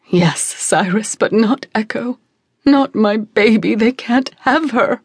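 slow, tearful plea; pleading mother. Acted. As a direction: beg for her child.

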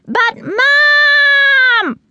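long, high wail at mother; squealed protest. A narrator's reading. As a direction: whine loudly, stretching out the last word. Chick protests at mother's refusal.

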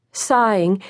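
ordinary delivery

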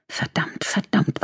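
hushed, panicked whisper; whispered cursing. A narrator's reading. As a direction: curse under the breath. Gunman's panicked inner thoughts.

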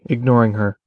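usual speaking voice

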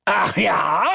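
high shouted retort; bellowing. A narrator's reading. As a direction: bellow it after someone leaving. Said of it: "brüllt ihm mein Vater hinterher".

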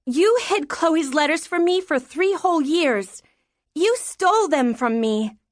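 high-pitched, furious accusation without shouting; mad child. A narrator's reading. Daughter accusing mother of stealing letters, mad. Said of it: "She was too mad to care".

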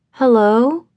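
high, questioning woman's voice; tentative. A narrator's reading. Tentative woman querying amid the whirlwind of sound, tentative. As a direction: lift it into an unsure question. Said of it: "queried the voice of a tentative woman".

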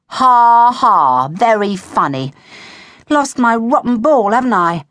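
loud, drawn-out sarcastic mock laugh and retort; heavy, slow sarcasm. A narrator's reading. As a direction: grumble back at teasing friends. Sarcastic retort to teasing friends.

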